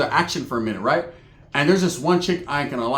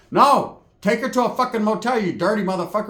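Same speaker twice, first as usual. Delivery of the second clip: hammed-up scolding; outraged aunt; 5 semitones higher than usual. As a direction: bark it like an aunt telling off a nephew. Aunt's outraged refusal, acted.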